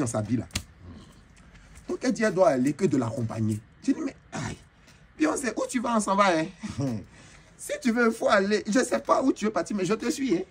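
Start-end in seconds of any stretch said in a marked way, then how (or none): 4.0-4.6 whispered aïe. Retold or imitated. Gasp at [name]'s invite. 5.2-10.4 eager high chatter. Retold or imitated. Eager to follow [name].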